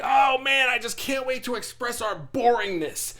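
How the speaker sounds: high-pitched excited shout, pure sarcasm; mock enthusiasm. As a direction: burst out with fake eagerness. Sarcastic quote of eager boring people.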